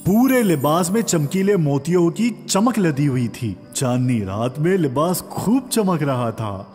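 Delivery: ordinary delivery, nothing marked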